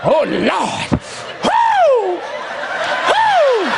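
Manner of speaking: high joyful whoops; church whoop. Acted. Preacher whooping at the congregation, high joyful cries. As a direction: cry out woo to the rafters.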